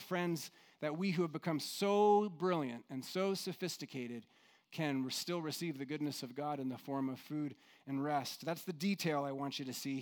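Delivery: ordinary talk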